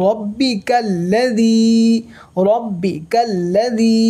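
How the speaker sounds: রব্বিকাল্লাযী recited slowly with যী held long; one-alif stretch. Shown as a demonstration. Quran teacher applying the madd rule for learners, one-alif stretch. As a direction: hold only the final যী of রব্বিকাল্লাযী. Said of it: "জি এক আলিফ টান হবে".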